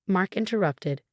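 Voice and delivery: ordinary delivery, nothing marked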